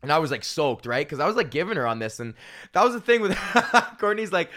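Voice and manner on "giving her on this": usual speaking voice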